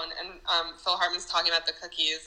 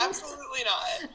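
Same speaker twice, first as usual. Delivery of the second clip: laughing denial; 8 semitones higher than usual. Real, not acted. Laughing reversal after joke.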